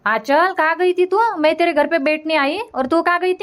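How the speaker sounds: high call to someone arriving; mother-in-law calls out to daughter-in-law, high calling out. Acted. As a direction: call her name from across the way.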